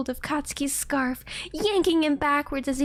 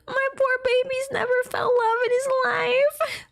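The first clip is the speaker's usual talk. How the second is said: much higher gushing squeal; 12 semitones higher than usual. Real, not acted. Reader gushes over character.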